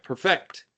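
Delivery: "perfect" stressed on the second syllable, per-FECT; wrong syllable stressed. Real, not acted. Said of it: "I don't know why I said perfect".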